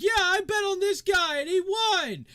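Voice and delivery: very high, loud, stretched-out holler; rowdy shout. Acted. Bettor bragging to the crowd, shouting excitedly. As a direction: yell it like a winning bettor in a crowd.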